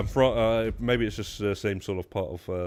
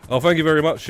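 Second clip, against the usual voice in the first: higher and louder, a burst of real delight; delighted thanks; 8 semitones higher, 11 dB louder, faster than usual. Real, not acted. Streamer thanking a viewer for support, delighted and louder. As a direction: beam out a surprised thank you.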